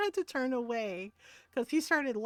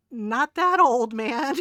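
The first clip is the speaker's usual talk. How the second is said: louder, slow, mock-offended protest; aunt playfully insulted by her nephew, louder; mock indignant; 8 dB louder, slower than usual. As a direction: push back, playfully offended. Defending her age to nephew.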